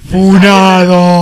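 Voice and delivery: long, booming call-out; mock announcement shout. Friend jokingly declaring someone cancelled, long loud shout. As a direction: bellow it out, hugely drawn out.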